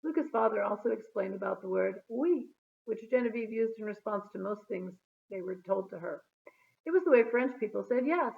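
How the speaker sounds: ordinary delivery, nothing marked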